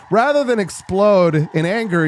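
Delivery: ordinary talk, nothing marked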